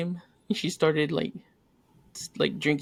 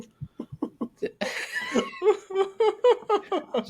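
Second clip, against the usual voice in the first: big laugh. Real, not acted. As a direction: crack up at your own joke. Laughs after teasing wife.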